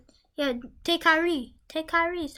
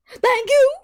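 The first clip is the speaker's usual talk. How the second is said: excited; 11 semitones higher, 11 dB louder than usual. Real, not acted. Thanking the pack, excited.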